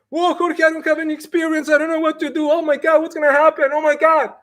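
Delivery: high-pitched mock panic; hammed-up worried whine; panicked newbie. Acted panicking newcomer without experience.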